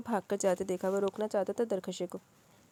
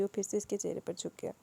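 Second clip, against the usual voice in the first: plain reading voice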